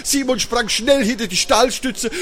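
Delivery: high, tense storytelling; suspenseful story narration. Acted. Storyteller tells children of hideous noises in the hall, suspenseful.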